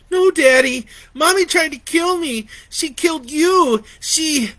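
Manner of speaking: put-on high loud frantic child; panicked crying. A narrator's reading. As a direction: insist in terror.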